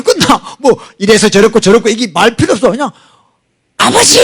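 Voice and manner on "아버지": high cry of Father; high-pitched call to Father; mock cry of Father. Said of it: "하면 끝나는 거예요".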